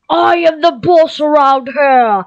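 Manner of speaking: loud, high, drawn-out boast; mock boss. Gamer jokingly claiming to be boss, hammed-up loud boast. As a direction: declare it slowly like a hammed-up tyrant. Playfully declaring who is boss.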